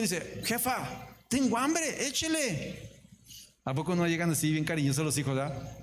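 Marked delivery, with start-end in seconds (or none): 0.4-3.0 agudo y brusco, chico malcriado; hijo exigente. Pretend. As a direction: pide la comida sin rodeos. Hijo exige comida a su madre. (el hijo le exige comida a su madre, agudo y brusco)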